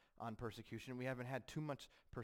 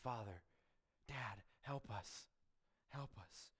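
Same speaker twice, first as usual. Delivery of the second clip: softer, slow plea to a father; tender plea; 4 dB quieter, slower than usual. Acted. Believers crying to God as Father, soft slow tender plea. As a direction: ask Dad for help like a trusting child. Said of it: "come to him and cry out".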